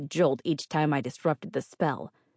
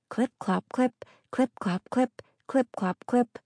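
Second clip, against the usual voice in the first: hoofbeat sounds; 8 semitones higher than usual. A narrator's reading. Hoof steps imitated.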